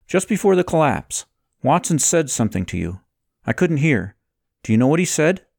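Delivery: high-pitched character questioning a friend; Levi, pressing for answers. Acted. Voices Levi questioning Kalen.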